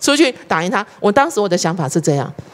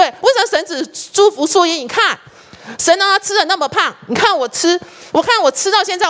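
higher, mock-indignant grumbling about being overlooked; imitating a complaint; 7 semitones higher than usual. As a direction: play the envious church member, lightly hammed-up. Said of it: "就直接跟我讲说". (模仿组员抱怨神偏心，略带夸张的不满)

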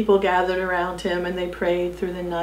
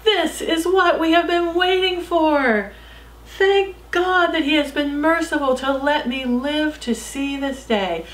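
higher, proclaiming voice; shouted thanks; 7 semitones higher than usual. Acted. Amma proclaiming to the celebrating crowd, mild raised voice. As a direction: lift it to the whole crowd. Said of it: "and he shouted".

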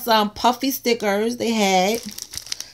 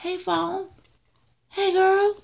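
put-on higher, softer playful girlfriend voice; mermaid voice; 7 semitones higher, 4 dB quieter than usual. Mermaid sticker greeting the phone, playful high voice. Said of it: "She's like".

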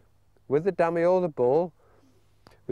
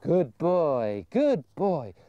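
coaxing recall; 8 semitones higher than usual. Shown as a demonstration. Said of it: "And if he was to go".

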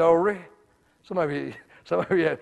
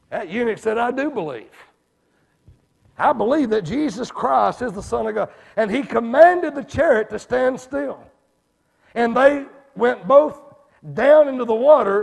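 higher, louder preaching build; preaching at full force; 8 semitones higher, 9 dB louder than usual. Acted. Preacher confirming his teaching to the church, fervent. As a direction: press the teaching with fire.

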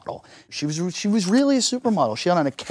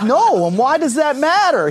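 indignant surprise; 10 semitones higher than usual. Retold or imitated. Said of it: "I'm like".

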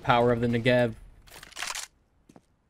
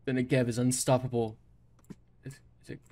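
ordinary delivery

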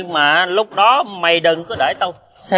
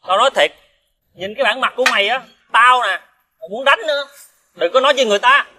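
high-pitched, heated warning; angry threat; 6 semitones higher, faster than usual. Acted. Friend on the verge of hitting his debtor, angrily threatening. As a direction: warn him off in rising anger. Quarrel close to blows.

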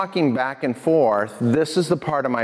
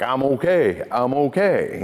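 put-on drunk driver; slower than usual. Imitating drunk driver standing up.

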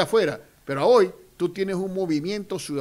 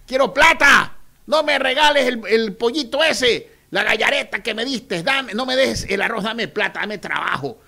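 higher, louder street shouting; people shouting; 4 semitones higher, 7 dB louder than usual. Retold or imitated. People in the street yelling at the candidate, loud demands. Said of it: "la gente te grita".

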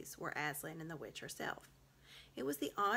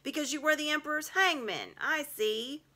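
high, loud mocking beaver voice; 7 semitones higher, 8 dB louder than usual. A narrator's reading. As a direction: sneer it out triumphantly. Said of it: "said Mister Beaver".

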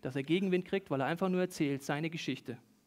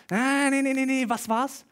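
high, louder groping for the answer; frustrated guesser; 7 semitones higher, 5 dB louder than usual. Acted. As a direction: act out a charades player rejecting a wrong guess. Voices people guessing at charades.

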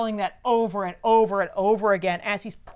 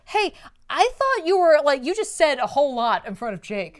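higher, louder mock scolding; mock scolding wife; 5 semitones higher, 6 dB louder than usual. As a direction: tease him like a wife scolding. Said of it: "Barda's like".

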